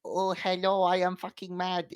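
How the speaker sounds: mock Scandinavian accent, pitched slightly up; stupid little Scandinavian voice. Podcaster mocks annoyed Scandi players, stupid little Scandinavian voice. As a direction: do a silly little Scandi gamer voice. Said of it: "in their stupid little fucking voice".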